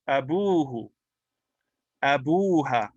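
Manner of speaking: abuhu and abuha with bu held long; long waw vowel. Shown as a demonstration. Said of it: "it becomes a waw".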